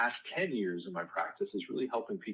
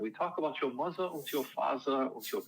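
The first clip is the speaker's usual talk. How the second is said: a lightly put-on Viennese German accent, a bit higher; a hint of a hammy Freud playing psychoanalyst; jokey, half-hearted German-accented therapist; terrible Freud accent; 5 semitones higher than usual. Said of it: "I'll do my terrible Freud accent".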